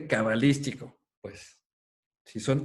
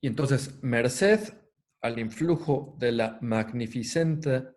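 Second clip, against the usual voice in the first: reverent reading of a sacred passage; solemn text reading. Acted. Lecturer reads a quoted hymn to Tripura to students, solemn reading. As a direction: read it out like scripture, with weight.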